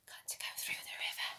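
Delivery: quick breathy whisper; fast whisper. A narrator's reading. Storyteller to children, a fast whisper. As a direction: rush it under your breath.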